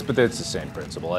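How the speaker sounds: usual speaking voice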